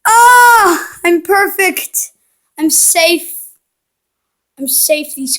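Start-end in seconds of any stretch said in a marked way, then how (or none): 0.1-1.0 sharp high startled cry; startled yelp. Real, not acted. Gamer yelps as bees chase him, high startled cry. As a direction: jump at the scare, high and loud.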